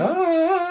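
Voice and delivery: put-on high held "ah" like eerie little children; sustained high choral note, imitated; imitated child choir. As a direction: mimic creepy horror-film children's music. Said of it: "little children going".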